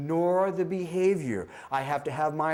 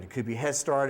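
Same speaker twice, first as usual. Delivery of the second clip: ordinary talk, nothing marked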